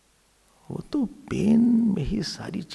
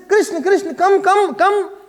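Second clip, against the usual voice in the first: high, loud calling after him; 9 semitones higher, 5 dB louder than usual. Retold or imitated. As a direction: call him back pleadingly. Gopi calling Krishna back.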